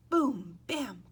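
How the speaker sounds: plain reading voice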